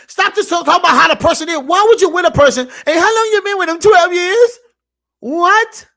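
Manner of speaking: loud, high preaching shout, slowing at the end; fired-up preaching. Real, not acted. Vlogger preaching to his viewers, a loud shout. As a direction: drive the point home like a street preacher.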